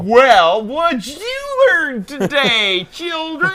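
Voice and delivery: put-on loud, high, slow sing-song to children; mock children's host. Host asking his friends as if children, exaggerated sing-song. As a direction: stretch it out like a hammed-up kids' presenter.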